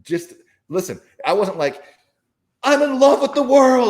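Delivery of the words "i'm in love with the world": loud, high, overblown ecstatic gush; mock gushing. Mocking a blissed-out version of himself, loud giddy gush. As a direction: ham up a giddy lovestruck cry.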